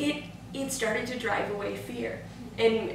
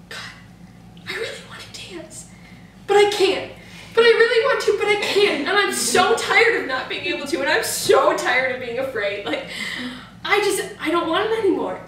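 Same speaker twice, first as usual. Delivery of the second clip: high, loud, pleading outburst to God; pleading cry to God; 8 semitones higher, 7 dB louder than usual. Retold or imitated. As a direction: beg God, half laughing, that you want to dance. Praying, longing to dance at camp.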